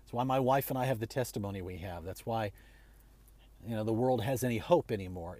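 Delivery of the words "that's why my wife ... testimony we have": ordinary talk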